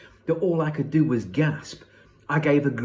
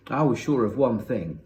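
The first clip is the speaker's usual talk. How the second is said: usual speaking voice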